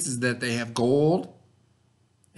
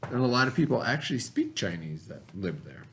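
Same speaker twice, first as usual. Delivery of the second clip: ordinary talk, nothing marked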